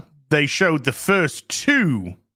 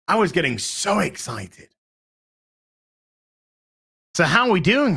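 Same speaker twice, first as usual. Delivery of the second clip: ordinary delivery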